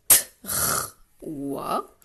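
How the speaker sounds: "t, r, oua." as three isolated sounds with pauses; sounded-out word. Shown as a demonstration. Teacher makes trois sing for pupils, sounded out. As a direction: break it into "t, r, oua.", each sound by itself. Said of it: "je fais chanter".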